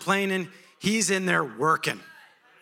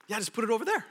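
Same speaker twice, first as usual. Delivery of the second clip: high, laid-back casual voice; high easygoing angel; chill angel; 6 semitones higher, much faster than usual. Retold or imitated. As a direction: wave it off lazily.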